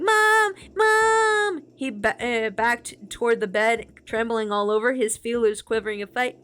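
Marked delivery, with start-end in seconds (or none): 0.0-1.6 frightened calling out. Acted. Frightened boy calls for mom.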